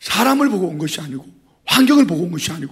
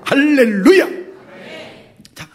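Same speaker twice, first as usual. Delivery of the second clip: normal talking voice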